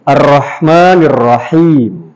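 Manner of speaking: "Arrahmanirrahim" slow and low, long vowels; low, drawn-out "Arrahmanirrahim", falling at the end; recitation for repeating. Shown as a demonstration. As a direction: lead the class through a stretched "Arrahmanirrahim". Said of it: "coba bilang semuanya".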